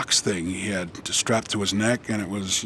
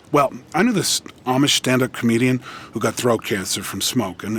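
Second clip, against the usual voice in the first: ordinary talk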